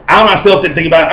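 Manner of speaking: ordinary talk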